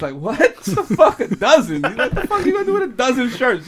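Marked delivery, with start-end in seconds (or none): 0.1-1.8 loud, slow, laughing disbelief. Retold or imitated. Plug laughing at tiny order. 2.1-3.7 laughing scoff. Retold or imitated. Plug mocking the dozen order.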